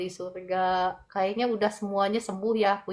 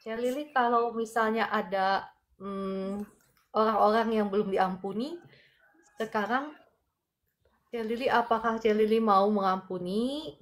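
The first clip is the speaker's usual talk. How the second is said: fervent healing prayer. Acted. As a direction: pray with rising force, pressing in. Healing prayer over a viewer. (doa penyembuhan yang berapi-api)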